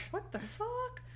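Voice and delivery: high, hushed squeak of disbelief; mock disbelief. Maggie watches Ma kiss Andy, mock disbelief. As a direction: say it small, high and stunned. Unhappy girl's shocked reaction, voiced.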